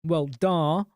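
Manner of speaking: ordinary talk, nothing marked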